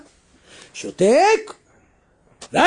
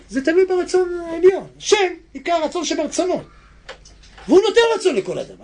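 much higher, louder urgent preaching; preaching at full force; 10 semitones higher, 4 dB louder than usual. Acted. Preacher urges listeners that God gives will, fervent. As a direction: press the point hard. Preaching on desire, high and loud.

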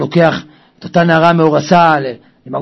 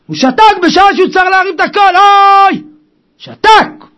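high, loud, urgent cry; preaching shout; 11 semitones higher, 4 dB louder than usual. Real, not acted. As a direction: thunder out the rebuke. Preacher decries Job's silence. (צעקת דרשן)

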